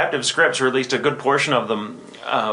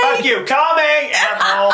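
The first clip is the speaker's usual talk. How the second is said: mock yell; 13 semitones higher, 3 dB louder than usual. Repeating the joke line.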